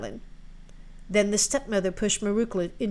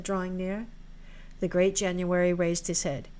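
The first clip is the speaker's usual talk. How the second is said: plain reading voice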